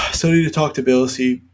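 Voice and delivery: talking through a yawn; words stretched by a big yawn. Real, not acted. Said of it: "sorry for yawning".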